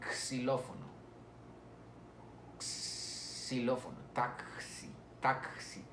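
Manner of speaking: xilófono and taxi with the X drawn out as K-S; X sound. Shown as a demonstration. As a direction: slowly stretch the X in xilófono, taxi. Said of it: "Y la X de xilófono".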